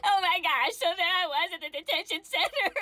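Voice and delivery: ordinary talk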